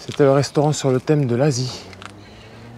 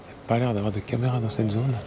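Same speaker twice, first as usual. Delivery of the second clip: usual speaking voice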